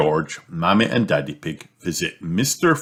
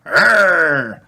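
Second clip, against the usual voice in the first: loud high little dinosaur roar; a piglet playing dinosaur; toddler roar; 10 semitones higher, 6 dB louder than usual. A narrator's reading. Toddler roaring at mended toy, loud high roar. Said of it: "replies George".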